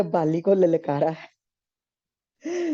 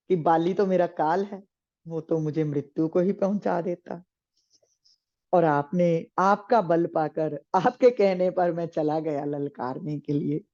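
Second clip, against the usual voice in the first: ordinary delivery, nothing marked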